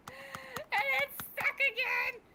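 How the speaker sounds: high, slow laughing cry; laughing wail. Real, not acted. Game stuck, laughing cry.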